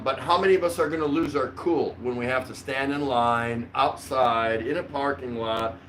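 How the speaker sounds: ordinary talk, nothing marked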